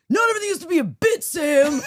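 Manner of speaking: high, loud, rapid outburst; exasperated yell. Acted. Husband turning to Sam mid-vows, exasperated yell. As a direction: holler it like a fed-up husband. Acted husband snapping at altar.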